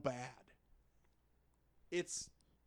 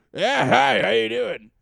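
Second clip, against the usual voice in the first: much higher, loud mocking thug; cocky thug voice; 7 semitones higher, 18 dB louder than usual. Thug greets the hero, loud and mocking. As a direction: greet him like a smug street thug.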